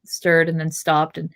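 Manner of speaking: plain reading voice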